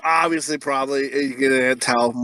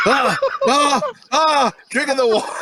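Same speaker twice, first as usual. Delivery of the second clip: gulping gasps; 9 semitones higher than usual. Retold or imitated. Retelling a roommate gulping water, mock gasps. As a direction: gasp like you just crossed a desert.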